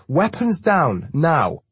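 loud, high, drawn-out order; shouted command. A narrator's reading. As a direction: order armed men to stand down. Shouted order to armed men.

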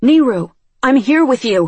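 loud, high desperate call; shout. A narrator's reading. Narrator shouting to her panicking friend, desperate. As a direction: shout to reach him through the panic. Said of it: "I shout".

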